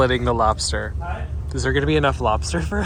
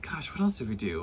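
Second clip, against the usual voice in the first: whispered aside to herself; whispering; 3 semitones lower, 8 dB quieter than usual. Real, not acted. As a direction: breathe it as a quiet aside. Musing aside.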